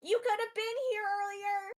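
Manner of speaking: high, loud retort; full defiant holler; yell back. Acted. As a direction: shout back from the far end of a room. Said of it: "yell back".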